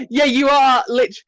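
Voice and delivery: loud, high, laughing retort; real laughing protest. Real, not acted. Game master teasing a player, laughing loudly. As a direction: burst out laughing as you talk.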